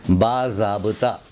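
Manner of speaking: बाज़ाब्ता slowly, with a clear z; correct pronunciation. Shown as a demonstration. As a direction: say बाज़ाब्ता as "baa-zaab-ta". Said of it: "इसको बाज़ाब्ता ना बोल के".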